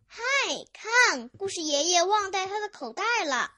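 high-pitched chick's call to the others. Acted. Chick spots grandpa's forgotten bag, calling out. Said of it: "卡门喊道".

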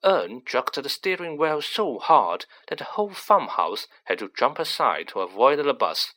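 usual speaking voice

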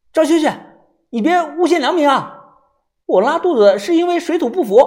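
high, loud rebuke; indignant. Acted. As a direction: snap back at a false accusation. Accused man argues back.

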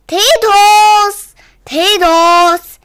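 owner calls out to the tortoise, high, loud call. Acted. Searching for the tortoise, calling out high and loud. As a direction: call loudly for a lost pet.

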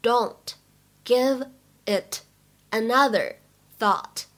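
"Don't, give it, another, thought" slowly, with a pause between chunks; word by word. Shown as a demonstration. Teacher models the line for learners, word by word. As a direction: separate "Don't", "another" and "thought" with clear gaps.

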